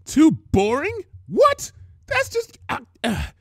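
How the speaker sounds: high, loud sputtering disbelief; indignant sputter. Acted. Pinkie sputtering at the too-boring claim. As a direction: sputter in outrage.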